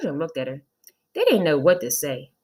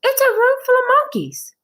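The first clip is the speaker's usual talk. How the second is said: high, loud, disbelieving squeal; shocked teacher; 7 dB louder than usual. Acted. Teacher shocked at her class, high loud squeal. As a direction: gasp it out in disbelief. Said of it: "said Missus Jules".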